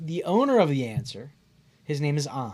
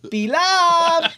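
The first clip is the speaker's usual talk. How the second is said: high, loud mimicked holler; imitated phone greeting; 12 semitones higher, 5 dB louder than usual. Bar owner taking the phone to call him back, mimicked high holler.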